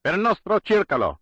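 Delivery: high, loud scream with a different voice; screamed Italian phrase. A narrator's reading. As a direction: hurl the phrase out like a rallying cry. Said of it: "as was the enigmatic phrase that followed".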